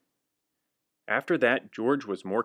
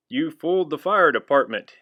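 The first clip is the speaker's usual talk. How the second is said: louder, higher scolding; 5 semitones higher, 6 dB louder than usual. Acted. Firemen scolding George, stern. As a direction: tell the monkey off. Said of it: "they said".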